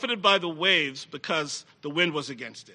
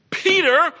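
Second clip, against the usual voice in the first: much higher and louder, full of wonder; fervent preaching; 12 semitones higher, 5 dB louder than usual. Acted. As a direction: proclaim it to the congregation.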